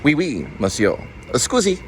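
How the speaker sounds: put-on playful, lightly done French accent; mock French. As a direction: toss off a hint of a French accent. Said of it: "French, as in".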